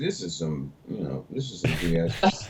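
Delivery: low, hushed confidential remark; retold aside. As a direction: imitate muttering a sceptical aside to a neighbour. Leaning over to confide in class.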